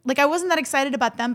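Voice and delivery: ordinary talk, nothing marked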